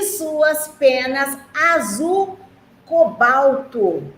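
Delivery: drawn-out stress on "cobalto", each syllable slow; slow way down on "cobalto" so kids catch it; teacher slowing on a new word; slow, careful reading. Acted.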